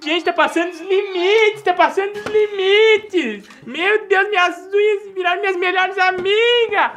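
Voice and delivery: high, excited exclamation; overexcited amazement. Pretend. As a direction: gasp it out in mock wonder. Amazed at the bubble nails. (espanto exagerado e agudo)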